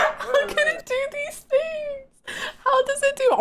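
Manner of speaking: amazed. Real, not acted. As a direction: squeal it out, half laughing. Amazed by the game clip.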